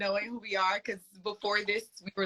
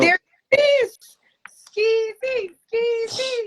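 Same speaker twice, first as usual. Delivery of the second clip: loud, high-pitched excited cheer; excited greeting; 10 dB louder, slower than usual. Real, not acted. Host hailing the guest as they join, excited greeting. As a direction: call out their nickname, high and loud.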